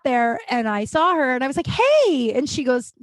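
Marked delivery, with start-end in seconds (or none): 1.7-2.3 mock cheery greeting. Greeting the bartender in story.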